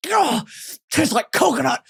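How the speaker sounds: lightly voiced Tony Stark tasting metal; a hint of a smug genius; mild imitation of a hero sampling a new element; Iron Man impression. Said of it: "that's a great impression".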